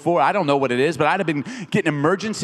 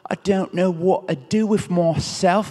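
meek whimper; 3 semitones higher, slower than usual. Retold or imitated. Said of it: "He's not like".

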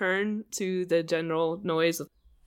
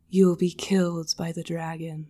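mystic voice. Acted. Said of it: "you hear this mystic voice say".

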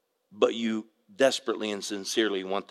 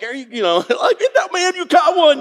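much higher, louder gleeful whoop; excited cheering; 11 dB louder than usual. Retold or imitated. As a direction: cheer him on like he just landed a big one. Cheering grandson's first catch.

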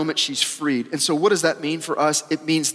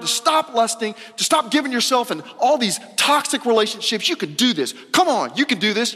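lightly voiced cheerleading coach, higher pitch; mock pep talk; 6 semitones higher than usual. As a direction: hype them up like a self-help guru, tongue in cheek. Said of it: "A pep talk's like".

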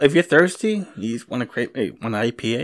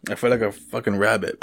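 normal talking voice

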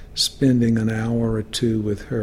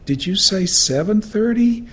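imitating a puzzled, higher-pitched question; querying old friend; 9 semitones higher, 4 dB louder than usual. Hard-of-hearing writer checks a visit time, querying in a raised pitch. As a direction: voice lifts high to check what was heard.

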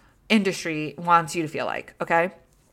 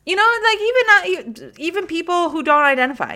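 squeaky excited; 11 semitones higher, 4 dB louder than usual. Real, not acted. Pitch leaps high mid rant.

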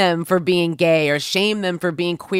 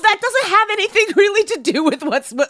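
B, what the hell rising in disbelief; exasperated disbelief, pitched up; incredulous; 6 semitones higher, 3 dB louder than usual. Real, not acted. Incredulous reaction.

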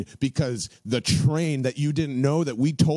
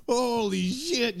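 higher, slow, softer disbelief; stunned awe; 8 semitones higher, 4 dB quieter, slower than usual. Real, not acted. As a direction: let it rise in awe, drawn out. Stunned by Daboll revelation.

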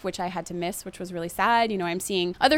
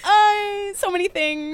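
high, loud blubbered reply; hammed-up sobbing overwhelm; mock tearful wail; 11 semitones higher, 6 dB louder, slower than usual. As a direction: imitate breaking down to a friend. Acting out her tearful breakdown.

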